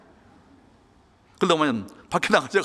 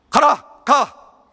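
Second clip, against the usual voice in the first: angry shout; 12 semitones higher, 8 dB louder, much slower than usual. Retold or imitated. Father drives son out.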